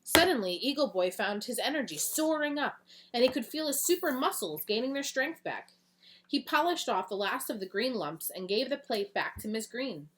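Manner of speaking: plain reading voice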